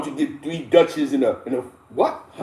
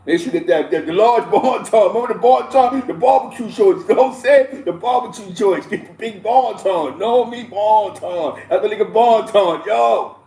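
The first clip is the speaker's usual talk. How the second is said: excited; 5 semitones higher, 4 dB louder than usual. Real, not acted. Excited about the snack.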